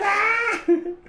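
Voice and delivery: high hammed-up yelp that breaks into a laugh; a fake cry of pain collapsing into giggles; mock scream into laughter. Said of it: "It's like".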